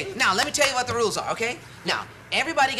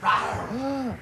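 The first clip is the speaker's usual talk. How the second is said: lion roar. Pretend. His turn pretending an animal.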